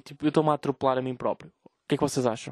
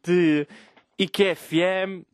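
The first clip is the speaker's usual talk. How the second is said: radio announcer; 3 semitones higher, slower than usual. Pretend. Radio host intro.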